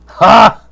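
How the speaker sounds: put-on high, loud drunk laugh; a sloshed man's burst of a laugh; drunken bark of a laugh. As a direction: drunk son scoffing at the toast. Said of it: "sloshed William goes".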